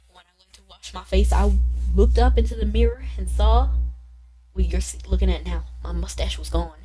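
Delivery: hushed Southern storytelling, gradually firmer; Walter. Acted. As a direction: recount it gravely in a Southern accent. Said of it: "giving him a southern accent".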